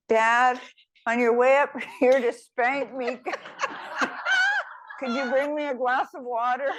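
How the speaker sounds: lightly voiced boy, laughing through the punchline; cheeky child. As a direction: teller laughing while playing the boy. Child cheekily asks again.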